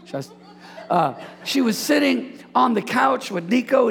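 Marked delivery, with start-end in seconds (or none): none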